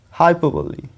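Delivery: हाइपरबोली as hy-PUH-buh-lee, no r; four beats in हाइपरबोली, ending in lee; correct pronunciation. Shown as a demonstration. Teacher gives students the right form, हाइपरबोली as hy-PUH-buh-lee. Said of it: "उसके बाद प पे stress है".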